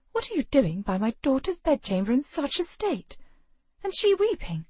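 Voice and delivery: lightly voiced noble mother rising in alarm; a hint of an outraged lady. A narrator's reading.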